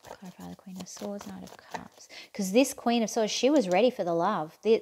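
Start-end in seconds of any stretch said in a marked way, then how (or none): 0.0-2.1 very soft, under the breath; murmured request. Real, not acted. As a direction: murmur it to the cards. Repeats request while shuffling cards.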